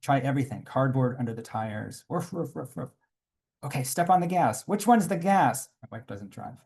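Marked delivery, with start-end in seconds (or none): 2.1-2.9 softer barking; mock dog barking; imitated dog barks. Dogs barking again. 3.6-5.7 flustered. Retold or imitated. Panic pushing the stuck car.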